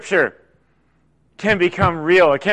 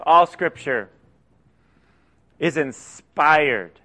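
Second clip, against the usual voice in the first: scripture reading; 4 semitones lower than usual. Acted. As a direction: lay each phrase down deliberately like holy text. Reads scripture passage aloud.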